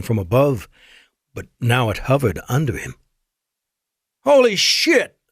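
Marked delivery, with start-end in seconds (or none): 4.2-5.2 sudden high, loud curse; yelling as he falls toward the ship; scream. A narrator's reading. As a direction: blurt it out in terror. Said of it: "Jake screamed".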